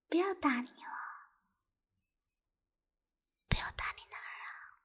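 taunting whisper. Acted.

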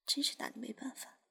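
soft sighing whisper. Acted. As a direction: breathe it out, barely voiced. Sighing, teasing the listener.

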